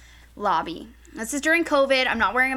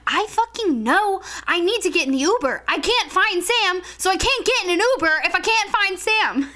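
higher, slowed emphatic complaint; frazzled, raised-pitch protest; retold exasperation; 4 semitones higher than usual. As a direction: insist down the phone, at wits' end. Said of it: "yelling at me".

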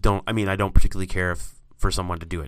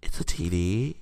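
stoner voice; 3 semitones higher, 6 dB quieter than usual. Pretend. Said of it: "talking like a stoner".

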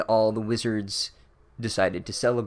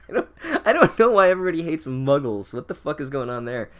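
laughing through fast words, higher and louder; amused by the story, laughing; 6 semitones higher, 5 dB louder, faster than usual. Real, not acted. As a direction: crack up while asking. Laughing comment.

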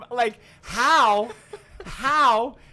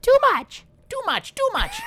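much higher sex robot voice, near shouting; 4 dB louder than usual. Pretend. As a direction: squeal it as a sex doll robot. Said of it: "Do your sex doll voice again".

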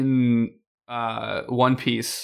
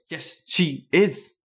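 slow, choppy staccato delivery; 3 semitones higher, slower than usual. Retold or imitated. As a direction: clip each word apart, evenly. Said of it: "Like, super staccato".

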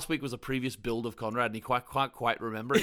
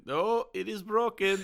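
high, drawn-out complaint; Mustafa; 7 semitones higher, slower than usual. Acted. Co-host quotes injured henchman complaining, high put-on voice. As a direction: whine it slowly, pitched up, as a put-on character. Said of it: "just complains loudly for the whole time".

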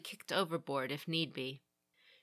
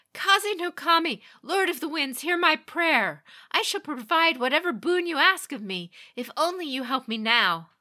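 high, louder invocation; fervent prayer; 10 semitones higher, 8 dB louder than usual. A narrator's reading. Meiko praying to the wind kami for help. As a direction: call upon the wind god with fervor.

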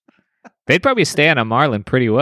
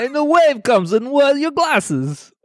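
high, hammy Russian comedian voice; Russian accent; 13 semitones higher than usual. Acted. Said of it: "sounds like a Russian comedian".

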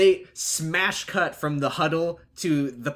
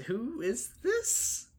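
very high, slow mock-girl voice; imitated girl on the phone; 10 semitones higher, 6 dB quieter, much slower than usual. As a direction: draw it out high and soft. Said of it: "And she goes".

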